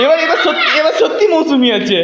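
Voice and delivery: laughing coax. Real, not acted. Coaxing child with laugh.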